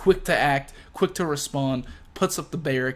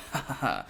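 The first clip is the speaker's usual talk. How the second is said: mock a plotting villain's laugh; acted sly "ha, ha, ha"; scheming laugh; 2 semitones lower, 6 dB quieter than usual. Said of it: "They're sitting here just scheming".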